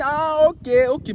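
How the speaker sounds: high, loud mock woman agreeing; hammed-up naive woman; woman's voice. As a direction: give in easily. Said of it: "Elle dit".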